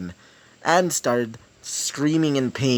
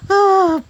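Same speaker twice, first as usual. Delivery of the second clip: villain laugh; 9 dB louder than usual. Acted. Villain laughs at being called monster.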